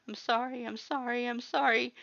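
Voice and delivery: high, tearful apology; girl sobbing into Mutt's sleeve. A narrator's reading. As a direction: choke out the apology through tears. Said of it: "you sobbed into his sleeve".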